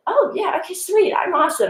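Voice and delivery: high mock-kid voice, pleased; kids' reaction. Teacher imitating her students, high and smug. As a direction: play the smug, delighted student. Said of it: "they'll be like".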